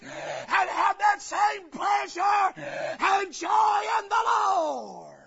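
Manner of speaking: loud, high, hammering preacher shout; preaching at full force. Acted. Preacher shouting to his congregation, loud and high. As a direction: drive it home to the congregation.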